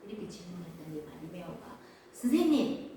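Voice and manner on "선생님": higher, louder call. Acted. Blind Bartimaeus answers Jesus, calling out. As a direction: call out to get his attention.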